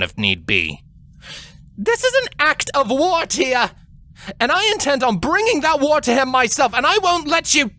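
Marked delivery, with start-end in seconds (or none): 1.8-7.7 higher, defiant and heated younger sister; defiant young princess. A narrator's reading. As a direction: push back hard against your sister. Twilight defies her sister.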